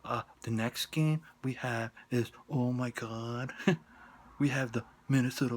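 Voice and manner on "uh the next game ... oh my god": ordinary talk, nothing marked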